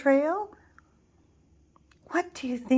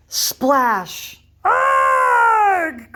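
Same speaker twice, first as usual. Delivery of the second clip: loud splash then higher yell; the bridge gives way; giant's splash and cry; 9 semitones higher, 11 dB louder than usual. Acted. Giant falls through the bridge, loud cry. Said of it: "cried the giant".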